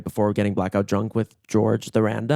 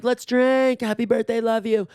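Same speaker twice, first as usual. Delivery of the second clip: excited greeting; 9 semitones higher than usual. Retold or imitated. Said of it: "I'm like".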